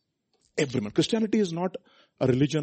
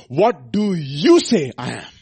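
louder, slow, drawn-out challenge; loud preached question; 3 semitones higher, 9 dB louder, slower than usual. Acted. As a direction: demand an answer from the crowd. Preaching at full force.

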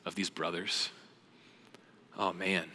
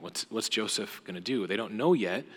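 ordinary delivery

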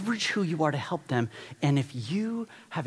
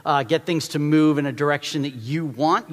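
normal talking voice

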